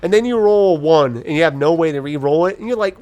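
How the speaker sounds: normal talking voice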